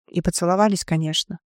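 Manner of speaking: usual speaking voice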